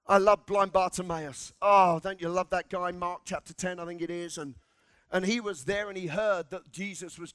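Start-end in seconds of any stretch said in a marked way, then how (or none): none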